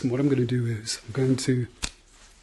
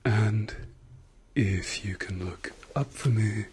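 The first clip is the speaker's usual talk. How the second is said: whispering; 3 semitones lower, 7 dB quieter than usual. Acted. ASMR doctor exam roleplay.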